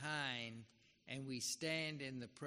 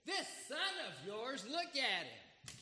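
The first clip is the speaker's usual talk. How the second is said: scornful son; 6 semitones higher than usual. Acted. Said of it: "the son says".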